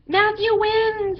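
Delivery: high, loud, slow cheer; yelled announcement. A narrator's reading. Judges yelling Matthew's victory to the beach. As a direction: proclaim the winner with joy. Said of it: "everyone yelled".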